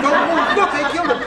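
high loud panicky cry; imitating panicky shouts at the wrestling; alarmed cry. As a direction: blurt it out high in mock alarm. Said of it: "He was saying".

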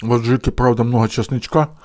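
spoon at the lips; speaking before a mouthful; talking while eating. Real, not acted. Seen 2: Lifts loaded spoon toward mouth while talking, spoon enters by 1.6.

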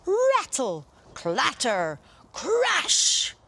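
high, loud sound-effect calls; noisy sound effects. A narrator's reading. Narrator shouts the giant's racket, high and loud. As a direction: bang them out like clattering pots.

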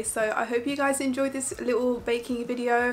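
normal talking voice

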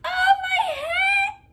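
very high, drawn-out squeal; piercing falsetto exclamation; mock shriek. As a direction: squeal it slowly, hammed up. Mock exclamation after wailed plea.

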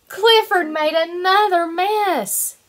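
bright, lively storybook reading; reading to children. Acted. Teacher reading a picture book to her kindergarten class, lively storytelling. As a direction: read it out like it's circle time.

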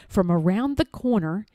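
plain reading voice

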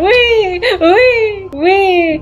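high, gleeful wee; excited squeal. Real, not acted. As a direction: squeal with delight on the way down. Gliding down a slide, squealing.

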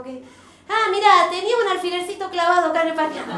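usual speaking voice

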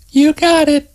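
high, teasing woman's voice; grinning. A narrator's reading. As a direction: tease him with a grin. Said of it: "she said".

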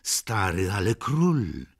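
put-on a girl finding her nerve, speaking boldly to her nurse; bolder. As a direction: say it boldly, gaining courage. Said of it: "rzekła śmielej".

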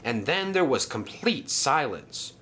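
ordinary delivery

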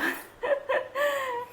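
high squeal of a laugh; giggling with joy; delighted laugh. Real, not acted. Mother sees baby wake.

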